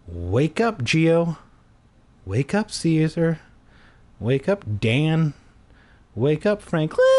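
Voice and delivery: wake-up alarm. Pretend. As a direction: play a spoken alarm clock. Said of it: "use it as your alarm in the morning".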